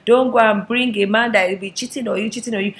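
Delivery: loud, high African mother exclaiming; scolding mother. Acted. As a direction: lament and warn, stretching the opening cry. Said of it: "they'll be like".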